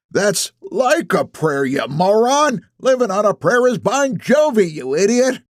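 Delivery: high, slow scolding; high, drawn-out exasperated insult; irate old man. A narrator's reading. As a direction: berate a fellow old-timer. Old men bickering over songs.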